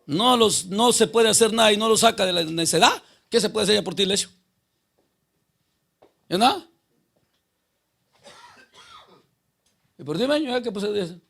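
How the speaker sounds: full-force preaching. Acted. Preacher driving the point home to the congregation, loud. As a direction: thunder it at the congregation.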